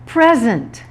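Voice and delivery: "Present" stressed on the first syllable; "Present" as a noun, front-stressed. Shown as a demonstration. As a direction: say "Present" as PREZ-ent. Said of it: "shift in the stressed syllable".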